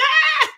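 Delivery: a sudden high shriek of joy, imitated; retold scream. Telling friends how the lady reacted to the rum, shrieking high. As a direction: let out the woman's wild happy scream. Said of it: "She started screaming and stuff".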